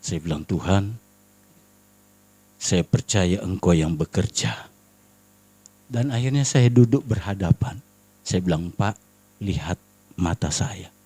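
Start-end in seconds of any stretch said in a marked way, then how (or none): 0.4-4.7 very low, hushed trusting prayer; quiet prayer. Retold or imitated. Doa pribadi di tengah bahaya. 8.7-10.9 very low, soft and careful; calm him with hushed words; gentle coaxing. Retold or imitated. Membujuk bapak yang marah.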